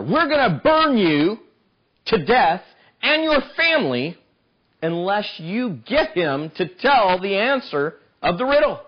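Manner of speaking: high, slow menacing threat; mock menacing villains; threatening Philistines. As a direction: lean in and threaten her. Philistines threaten Samson's wife.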